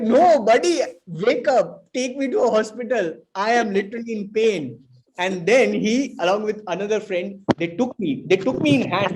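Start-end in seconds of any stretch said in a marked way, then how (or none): 0.0-4.8 high, louder pleading in pain; retold urgent plea. Pleading with sleepy roommate to rush him to hospital, high and urgent. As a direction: beg your friend to wake up now.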